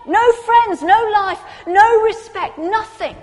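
fervent pulpit build to a peak; impassioned preaching. Acted. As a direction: preach it harder line by line.

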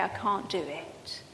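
faint, low admission of defeat; hushed surrender. Retold or imitated. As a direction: breathe it out like giving up. Despairing prayer quoted to God.

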